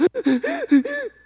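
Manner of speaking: sharp high gasp for air; mock panicked inhale; imitated panic gasp. Son having a panic attack.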